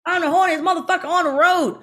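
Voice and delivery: high, loud blurted line; mock yelled line. Stuntwoman reenacting her mistaken yell, high and loud. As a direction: yell the wrong line at the driver. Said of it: "That's not what I was supposed to say".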